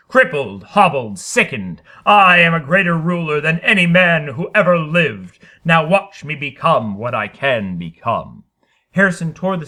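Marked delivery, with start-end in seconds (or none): 0.1-8.4 loud, proud bellow slowing at the end. Acted. Harrison bellowing his greatness to the studio. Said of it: "he bellowed".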